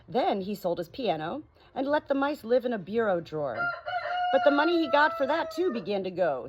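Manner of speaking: lively storybook reading, rising and falling; story narration. A narrator's reading. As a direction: read it like a bedtime story to friends. Reads story aloud.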